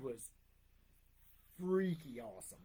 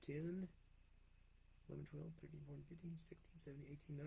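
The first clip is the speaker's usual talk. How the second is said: counting in a whisper; 3 semitones lower, 19 dB quieter, faster than usual. Real, not acted. Counting questions under breath.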